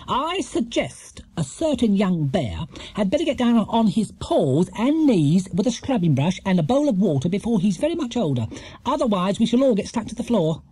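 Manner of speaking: brisk, bossy housekeeper voice; no-nonsense orders to the bear. A narrator's reading. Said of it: "said Missus Bird briskly".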